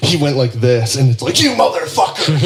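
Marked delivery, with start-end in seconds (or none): 1.4-2.4 high-pitched imitated road-rage outburst; mock road-rage yell. Driver jerking the wheel at another car, mock enraged yell. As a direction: mimic a driver blowing up, no real volume. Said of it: "it's road rage".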